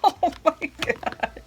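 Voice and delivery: loud, high startled laughing; shrieky relief, laughing through the shock; freaked out. Real, not acted. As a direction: squeal and laugh after a real scare. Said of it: "That just freaked me out".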